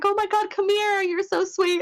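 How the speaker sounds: high-pitched, cooing mock greeting; gushing welcome. Fan retelling an actor inviting a hug, high gushing imitation. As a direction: imitate someone fussing sweetly over a fan.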